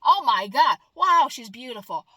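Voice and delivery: loud, startled gasps at a strange sight; amazed gawking. Retold or imitated. Imagined people meeting aliens, loud amazed gawking. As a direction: gawk out loud at the first alien. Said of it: "if we start saying".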